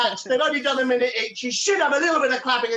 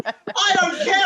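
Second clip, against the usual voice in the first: ordinary talk, nothing marked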